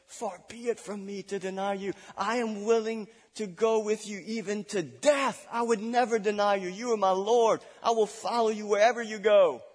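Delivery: a hint of an eager disciple; Peter's bold vow. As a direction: swear loyalty with rising fervor.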